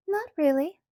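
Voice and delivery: high, dismissive sniff; sniffy. A narrator's reading. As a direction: brush it off with a sniff. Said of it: "she sniffed".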